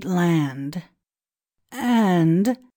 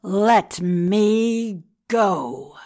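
loud, drawn-out, raised-pitch cry; priest's struggling cry; 5 dB louder, much slower than usual. Acted. Bound priest demanding release from the children, a crying-out voice. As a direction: cry out slowly while writhing to get free. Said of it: "cried the priest, writhing".

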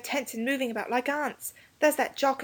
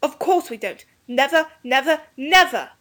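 put-on high boyish shout, building louder; defiant; 7 semitones higher, 4 dB louder than usual. A narrator's reading. Defiant refusal to surrender.

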